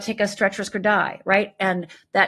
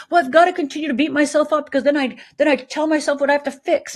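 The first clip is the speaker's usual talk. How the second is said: a client's voice, higher and louder, rushed; imitating a client; 6 semitones higher, 5 dB louder, faster than usual. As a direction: mimic a client justifying beating herself up. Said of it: "come to me and go".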